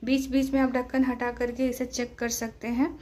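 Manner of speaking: ordinary talk, nothing marked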